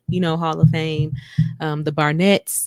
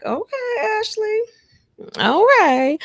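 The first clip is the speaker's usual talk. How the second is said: playful squeal of approval, ending louder; teasing approval; 3 dB louder than usual. Retold or imitated. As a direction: tease a friend who just got bold. Reacting teasingly to Ashley's remark.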